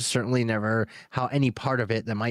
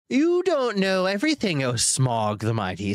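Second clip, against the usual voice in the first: Bilbo voice; 8 semitones higher than usual. A narrator's reading. Bilbo addressing the dragon.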